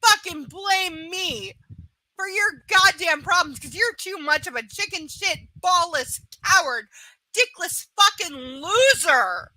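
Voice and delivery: scornful, forceful outburst; real angry rant. Real, not acted. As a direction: berate them, leaning hard on the curses. Furious rant at absent client.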